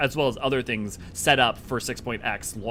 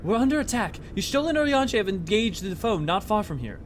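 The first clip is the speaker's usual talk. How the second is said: urgent, higher-pitched acted report; alarmed game character; 9 semitones higher than usual. Ally reporting Y'shtola has engaged the foe, urgent. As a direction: sound the alarm in a raised pitch.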